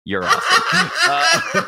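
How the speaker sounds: high, slow, laughing praise; delighted cheer. Retold or imitated. Spider-Man cheering the kid on, high and laughing. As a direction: gush at the kid through a laugh.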